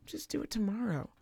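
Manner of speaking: softly tossed-off imitation of a husband; mock casual husband. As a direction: toss it off quietly, imitating him. Quoting her husband's dismissive advice.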